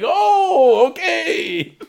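squeaky, laughing, high; a high, giggly oh okay; high laughing squeak. Retold or imitated. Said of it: "Aunt David's like".

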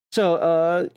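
usual speaking voice

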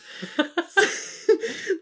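light breathy laugh. Real, not acted. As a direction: laugh at your own line. Laughs at own story.

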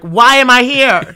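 high, loud, slow disbelief; inner shout. Retold or imitated. Called into the producer's office, an inner mock shout. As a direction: yell it inside your own head. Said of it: "But in my head, I'm like".

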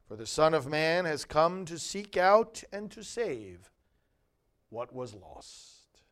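measured, solemn reading of scripture. Acted. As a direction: proclaim each phrase to the congregation. Gospel proclaimed at Mass.

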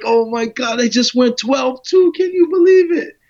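high, loud retold scream of disbelief; excited screaming. Racer coming back from a record run, screaming with excitement. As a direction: build to an elated, high-pitched yell. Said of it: "I'm screaming in the car".

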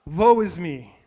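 Isaiah crying out before God. Retold or imitated. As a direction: cry it out like a prophet overwhelmed. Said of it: "he cries out".

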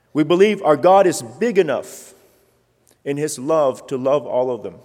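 loud, rising sermon build; preaching at full force. Real, not acted. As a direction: preach it out to the rafters.

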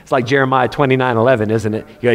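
ordinary talk, nothing marked

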